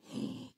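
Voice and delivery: soft, voiceless gasp; mock shocked gasp. Congregation listening to the man's confession, mock gasp. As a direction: suck in air like a scandalised onlooker.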